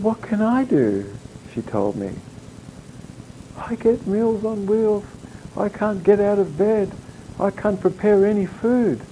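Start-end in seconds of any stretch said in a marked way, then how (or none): 0.0-1.1 tearful caller. Retold or imitated. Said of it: "she starts sobbing". 3.6-9.0 higher, softer, tearful voice for the caller; imitating a weeping caller. Doctor retelling a bedridden patient's tearful phone plea, high, soft and weepy. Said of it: "And she was weeping".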